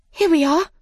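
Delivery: high, bright relief; relieved. A narrator's reading. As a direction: say it with happy relief. Alice finds chocolates.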